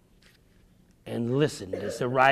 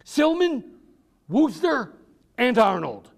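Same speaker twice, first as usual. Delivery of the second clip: lightly raised urgent messenger; 11 semitones higher, 4 dB louder than usual. A narrator's reading. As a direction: call out the names, urgent. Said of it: "a man's voice said".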